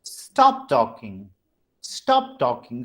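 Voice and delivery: slow STOP TALKING, stepping down from high to low; drawn-out command falling in pitch. Shown as a demonstration. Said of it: "from high pitch to the low pitch".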